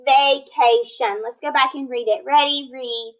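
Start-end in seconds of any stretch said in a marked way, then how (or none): 0.1-1.2 "vacation" slow, ending "shun" clear; slow teacher model; slow modelled word. Shown as a demonstration. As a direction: model it slowly for students. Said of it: "which says shun".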